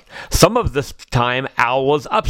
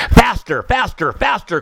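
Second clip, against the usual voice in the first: crying out; 6 semitones higher than usual. Acted. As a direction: urge himself on, pitched up. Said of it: "cried Owl".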